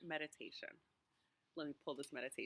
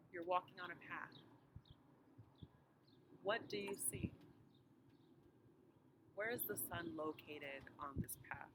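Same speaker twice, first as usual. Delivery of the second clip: unhurried meditation voice with long pauses; guided meditation; 3 semitones higher than usual. Real, not acted. Pastor walking congregation through a walk to Emmaus, guided meditation. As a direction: guide listeners gently, phrase by phrase, leaving space to breathe.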